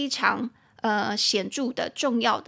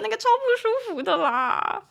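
laughing; 12 semitones higher, 4 dB louder than usual. Real, not acted. Laughing at toilet story.

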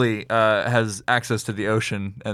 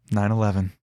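mild cashier impression; 2 semitones lower than usual. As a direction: quote the cashier's total lightly. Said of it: "That's what happened".

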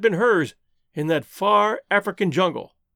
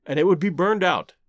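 usual speaking voice

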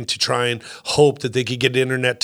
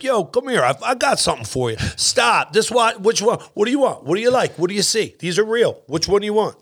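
higher, pushy street-vendor patter; New York hustler; 7 semitones higher than usual. Acted. Comic acting a New York salesman to a game-show customer, pushy hustler. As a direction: hustle the customer in quick, punchy questions. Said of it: "like, a New York American".

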